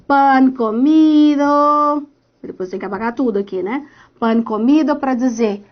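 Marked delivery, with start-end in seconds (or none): 0.1-2.1 very slow, stretched-out vowels on a held note; slow teasing singsong; playfully drawn out. Real, not acted. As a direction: draw it out playfully, holding each word. Playful cheer while drawing little heart.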